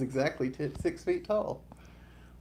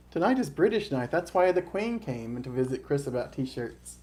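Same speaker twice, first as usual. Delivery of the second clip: ordinary talk, nothing marked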